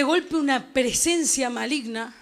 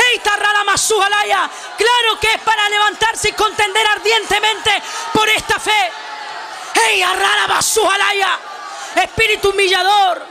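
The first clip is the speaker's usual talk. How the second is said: impassioned Pentecostal preacher; fiery, sustained revival shouting; full-force preaching; 3 semitones higher than usual. Real, not acted.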